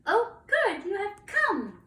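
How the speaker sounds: very high, loud, eager greeting; delighted young dragons. Acted. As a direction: cry out with high-pitched delight. Three dragons greet the knight.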